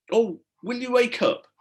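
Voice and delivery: plain reading voice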